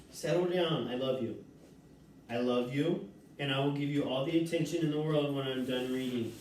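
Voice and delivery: low, soft reassuring coo; calm the pet with love; soothing. Real, not acted. Reader soothing Buckwheat, low and soft.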